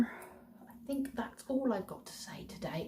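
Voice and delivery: slow, breathy whisper. Real, not acted. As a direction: drop into a hushed whisper. Soft aside at the vlog's end.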